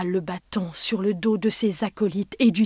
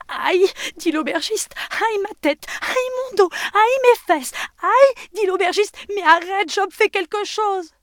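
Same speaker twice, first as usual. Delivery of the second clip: howling in pain; 12 semitones higher, 8 dB louder than usual. Acted. Innkeeper beaten, begs Job.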